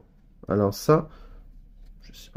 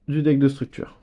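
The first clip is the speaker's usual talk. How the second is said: ordinary talk, nothing marked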